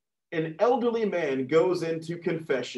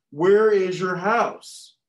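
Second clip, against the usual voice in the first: slow, louder, higher cop questioning; policeman; 4 semitones higher, 6 dB louder, much slower than usual. Acted. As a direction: press each word like an insistent officer.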